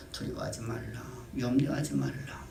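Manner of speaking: God quietly soothing his fearful people; tender voice of God. Acted. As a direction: comfort a frightened child, gently. Voicing God comforting believers.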